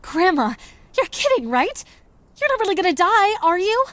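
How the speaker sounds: high, loud, anxious pleading question; alarmed disbelief. Acted. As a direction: question grandma in alarm, pitch leaping up. Granddaughter alarmed grandma will die.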